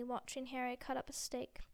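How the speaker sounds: plain reading voice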